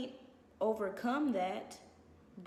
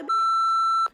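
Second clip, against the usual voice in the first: sudden loud punch; 13 dB louder than usual. Real, not acted.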